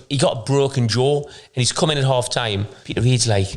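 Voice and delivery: ordinary delivery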